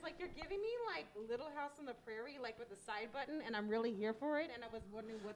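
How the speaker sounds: muffled, mic covered by hand; hand over the mic. Real, not acted. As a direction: talk through a covered microphone. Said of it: "You're covering the microphone with your hand".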